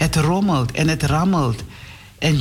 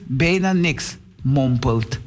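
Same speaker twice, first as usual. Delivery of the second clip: usual speaking voice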